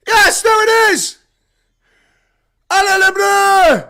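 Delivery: roaring Allez les Bleus call; frenzied play-by-play. Real, not acted. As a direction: scream the attack home. Frenzied call as France near line.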